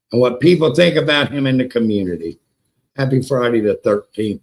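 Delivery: normal talking voice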